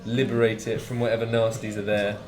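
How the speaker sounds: ordinary delivery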